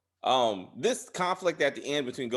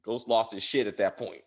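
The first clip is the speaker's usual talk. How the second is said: ordinary delivery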